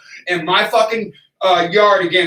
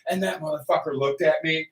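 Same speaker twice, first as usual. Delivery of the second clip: ordinary talk, nothing marked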